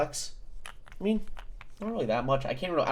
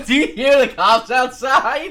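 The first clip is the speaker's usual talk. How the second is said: loud, high words through real laughter; laughing; 9 semitones higher, 9 dB louder than usual. Real, not acted. Streamer joking that police have arrived, laughing. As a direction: crack up while raising the alarm.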